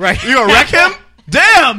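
loud, high excited yell; 1 hyped shout. Real, not acted. Host yelling in delight at a coworker's threat. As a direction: hype up the threat like a crowd.

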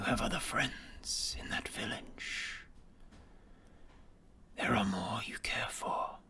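slow sinister whispering villain; menacing whisper. Acted. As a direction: whisper each threat slowly, coldly. Villain dragon threatens and bargains.

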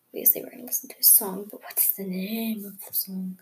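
quick playful whisper. Real, not acted. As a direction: whisper it like a secret. Playful aside to viewers.

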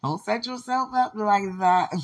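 mock warning, loud and slow; loud teasing scold. Real, not acted. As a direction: scold him loud and playful, drawn out. Teasing protest after laughing.

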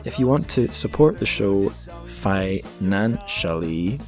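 ordinary talk, nothing marked

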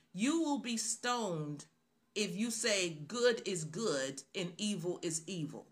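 usual speaking voice

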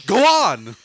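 loud, high yell; shouted reply. Acted. Player yelling instead of plainly saying go for it, shouted. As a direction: scream it out at full blast. Game of having to scream answers.

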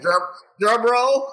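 mock drum roll announcement. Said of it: "You're like".

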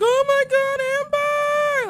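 high, slow, drawn-out scream of alarm; frantic stretched-out yell; panicked shout. Retold or imitated. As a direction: wail her name from the seats. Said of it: "all of a sudden, I'm like".

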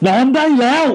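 high, loud mock yell into the ear; ghost man's shout. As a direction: bark it like a ghost telling them to sleep. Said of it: "ได้ยินเสียงผู้ชายตะโกนใส่หูว่า". (ผีผู้ชายตะโกนใส่หูให้นอน เสียงสูงดัง)

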